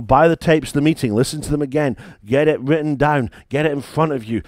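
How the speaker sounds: ordinary delivery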